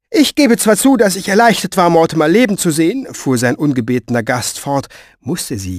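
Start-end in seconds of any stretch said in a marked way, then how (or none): 0.1-3.1 high, forceful, insistent torrent; brusque, pitched-up concession; bossy woman holding forth. Acted. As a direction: talk on urgently without yielding.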